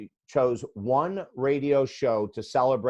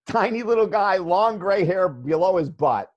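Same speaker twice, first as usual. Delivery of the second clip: laughing; 5 semitones higher, 5 dB louder than usual. Real, not acted. Laughing describing Maharishi.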